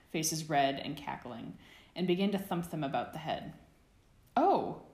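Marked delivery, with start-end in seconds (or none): 4.4-4.8 sudden high startled shout; a startled cry of surprise; surprised yell. Acted. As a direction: yelp of surprise mid-wrestle. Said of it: "James yelled in surprise".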